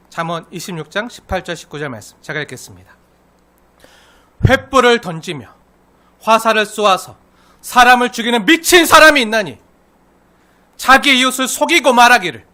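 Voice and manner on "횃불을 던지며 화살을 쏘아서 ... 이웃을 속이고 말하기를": loud, high proclamation; forceful scripture reading. Acted. Preacher reading Proverbs to congregation, forceful and loud. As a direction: proclaim the verse with full pulpit force.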